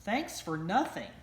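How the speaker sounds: usual speaking voice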